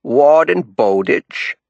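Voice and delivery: put-on woman in a thick Down East accent. A narrator's reading. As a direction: greet him in thick Down East Maine. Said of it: "in a thick Down East accent".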